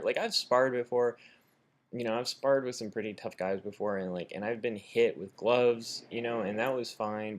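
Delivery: ordinary talk, nothing marked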